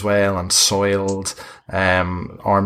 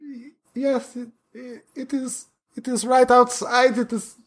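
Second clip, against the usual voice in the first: high, pleading and wretched; a starving vampire begs his master for release; starved pleading vampire. Acted. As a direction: beg like a starving creature locked away. Frightened spawn answers.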